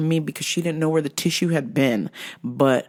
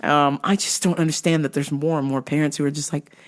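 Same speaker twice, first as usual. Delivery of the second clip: ordinary delivery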